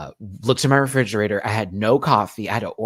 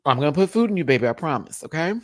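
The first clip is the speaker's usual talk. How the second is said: higher, louder, quicker promise; insistent reassurance; 4 semitones higher, 5 dB louder, faster than usual. Pretend. Promising own hungry tummy food, insistent. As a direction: insist it, hurried and emphatic.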